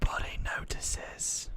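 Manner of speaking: slow, voiceless stage whisper; mock whisper. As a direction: whisper it slowly like a mock secret. Mock-secretive aside on unnoticed error.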